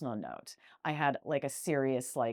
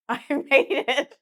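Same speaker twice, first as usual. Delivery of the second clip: laughing; 10 semitones higher, 9 dB louder than usual. Real, not acted. Laughing with triumph.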